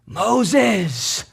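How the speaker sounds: a soft call from far off; faint faraway calling of Moses; distant call. Retold or imitated. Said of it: "he hears this call".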